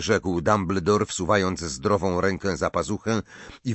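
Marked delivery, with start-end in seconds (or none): none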